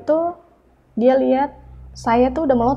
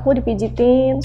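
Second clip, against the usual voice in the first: ordinary delivery, nothing marked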